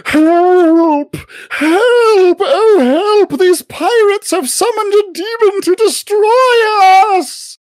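very high, wailing cry for help; Pomponius Goldfeather. Acted. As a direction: shriek theatrically for rescue across the decks. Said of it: "hear the voice of Pomponius Goldfeather call out".

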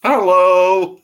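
loud, high, put-on Essex-style hello; mock Harlow hello. Mocking someone from Harlow, a loud put-on hello. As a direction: bellow a cheery local hello.

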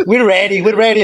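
put-on chirpy English with a Mexican accent, we're stressed. Band answering Conan, chirpy Mexican-accented English. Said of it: "le decíamos".

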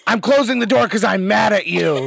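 mock sulky teen. Mock teen snapping at dad.

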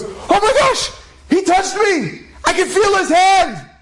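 very high hammed-up boy's shriek; mock panicked scream. Boy touched by the Rav he thought dead, mock panicked scream. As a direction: squeal it in acted terror. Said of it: "everyone starts screaming and yelling".

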